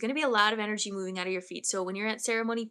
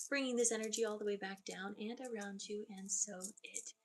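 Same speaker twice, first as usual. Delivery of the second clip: guided meditation; 7 dB quieter than usual. Acted. Medium grounding the client, soft guided meditation. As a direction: lead them down into the earth gently.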